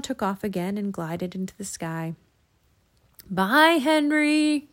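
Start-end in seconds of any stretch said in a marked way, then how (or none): 3.3-4.7 higher, louder farewell call. A narrator's reading. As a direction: call goodbye up to the sky. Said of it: "called Jack".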